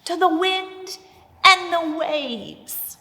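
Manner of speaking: high, loud and drawn out; slow, swelling cry over the waters; booming. Shown as a demonstration. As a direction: proclaim it as if rebuking the storm. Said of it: "like a father would do".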